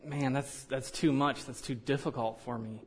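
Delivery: a quiet, defeated inner monologue; inner reluctance. Retold or imitated. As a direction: murmur it like you're talking yourself out of it. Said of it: "there's something in it that's".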